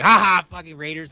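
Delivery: lightly acted mocking "ha ha"; imitated gloating. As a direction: gloat like a taunting coworker. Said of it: "And he's like".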